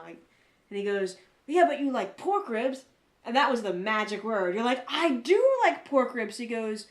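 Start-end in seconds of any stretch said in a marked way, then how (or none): 1.5-2.8 louder, higher, slow imitation of a tempting offer; South African guy, no accent. Quoting the South African guy offering ribs, lightly voiced. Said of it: "the South African guy". 4.9-6.4 loud, high, slow mock delight; won-over enthusiasm. Retelling her friend hearing the magic word, hammed-up enthusiasm.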